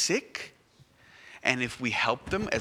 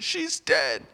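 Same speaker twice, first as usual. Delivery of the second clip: crying mother; much slower than usual. Acted. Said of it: "started crying and said".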